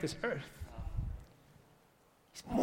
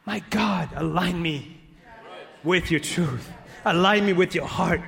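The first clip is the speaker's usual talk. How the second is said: prayer swelling steadily louder to a loud end; building prayer; 6 dB louder than usual. Acted. Pastor praying over the church, building loud prayer. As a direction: pray it, building with each line.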